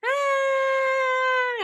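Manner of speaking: high, loud scream of delight; sudden high delighted shriek; girl's scream. Retold or imitated. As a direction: shriek like a thrilled child. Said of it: "and she's screaming".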